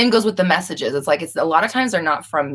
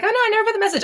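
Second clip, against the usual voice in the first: mock flustered viewer; much faster than usual. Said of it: "She's like".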